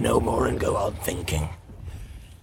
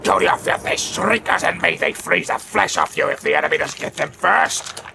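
furious orc rant; 6 semitones higher than usual. Acted. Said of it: "his voice was full of fear and rage".

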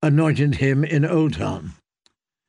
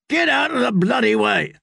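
much higher, angry roar; roaring knight; 9 semitones higher than usual. Acted. Knight roaring at people blocking the litter. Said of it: "roared Kettleblack".

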